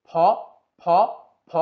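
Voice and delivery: ফ with lips pressed and a puff of air; F sound demo. Shown as a demonstration. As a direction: push air out through closed lips on ফ. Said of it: "আপনি যদি গলাতে হাত দেন". (ঠোঁট চেপে বাতাস ছেড়ে ফ উচ্চারণ)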